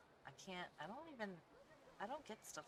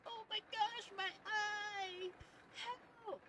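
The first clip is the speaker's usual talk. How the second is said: high, loud hammed-up horror shriek; fake panic scream; 7 dB louder, much slower than usual. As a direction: shriek like a kid faking a lost eyeball. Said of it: "she's like".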